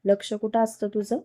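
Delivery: usual speaking voice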